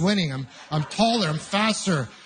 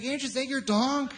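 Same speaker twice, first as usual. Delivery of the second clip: put-on sweet talk to toddler; 7 semitones higher, 6 dB quieter than usual. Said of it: "I was like".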